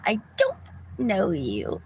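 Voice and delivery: hammed-up replay of the stranger's odd denial; imitating the woman. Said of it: "That's how she said it, exactly like that".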